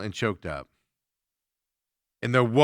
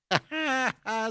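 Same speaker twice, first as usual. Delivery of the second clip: high amused laugh; 13 semitones higher than usual. Real, not acted. Host reacting to free beer for students, amused laugh. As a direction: burst of high-pitched laughter.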